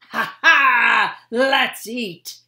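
loud hearty laughing call; captain delighted at the meal, loud and hearty; jolly exclamation. Acted. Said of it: "Delbert exclaimed".

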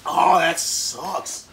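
slow, loud groan through burning mouth; mouth burning from spicy food. Real, not acted. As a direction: drag it out, mouth on fire. Burning mouth after hot chip.

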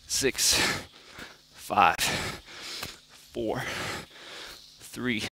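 quiet, strained counting mid push-up; counting workout reps. Real, not acted. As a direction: count reps under your breath while working. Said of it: "Starting to feel it a little bit".

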